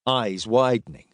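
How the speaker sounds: ordinary delivery, nothing marked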